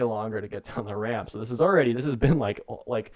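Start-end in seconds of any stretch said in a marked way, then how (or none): none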